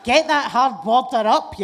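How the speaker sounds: a loud, joking yell; mock shout. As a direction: bellow it as a comic insult. Mock rant against the man.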